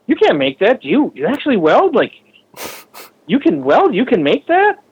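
high, incredulous imitation of a doubter; mock disbelief. People who can't believe he welds the bike, high mock disbelief. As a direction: ham up their amazement.

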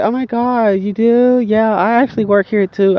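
high-pitched put-on woman's voice. Storyteller mimicking the woman learning they share a workplace, high mock-feminine voice. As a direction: mimic her surprised reply in a high voice.

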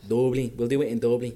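imitated Dublin pronunciation; Dublin accent. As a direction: put on a light Dublin accent. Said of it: "The way you guys actually".

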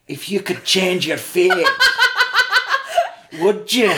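hint of a Scottish lilt through laughter; Scottish accent. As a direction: read the question like a Scottish woman. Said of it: "from a Scottish woman".

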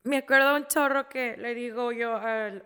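ordinary delivery

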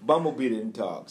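ordinary talk, nothing marked

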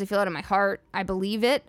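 ordinary delivery

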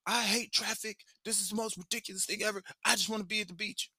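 high-pitched, rushed mock kid complaint; whiny frustrated son. Dad imitating his son stuck in beach traffic, whiny complaint. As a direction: imitate a fed-up child, high and quick. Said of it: "he's just like".

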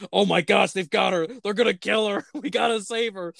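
a lightly hammed-up freakout; panicked college kids. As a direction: play the scared kids, half laughing.

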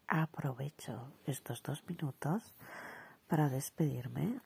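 slow, hushed mock-seductive sign-off; soft, drawn-out faux-sexy voice; put-on sensual voice. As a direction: play at a sultry farewell, taking it very slowly. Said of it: "con una voz sensual".

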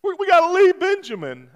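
high, tearful wife voice. Retold or imitated. Husband imitates his wife crying about leaving their son. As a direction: wail it like a worried mother. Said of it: "I'm like".

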